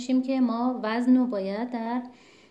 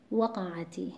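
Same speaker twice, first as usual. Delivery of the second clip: whispered example; 2 semitones lower, 3 dB quieter, slower than usual. Shown as a demonstration. Teacher whispering the example word وقعتی to students. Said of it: "مثلاً دامتی".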